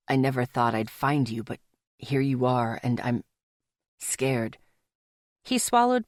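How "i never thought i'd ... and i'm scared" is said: low male lover's voice, hesitant and vulnerable; Rafe, lover, confessing fear. Acted.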